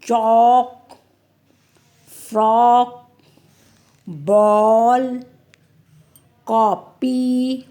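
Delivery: slow, careful reading with a rounded, open ऑ; chॉक and बॉल said slowly with a wide ऑ; open o sound. Shown as a demonstration. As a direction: stretch the open vowel for the class. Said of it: "ओ".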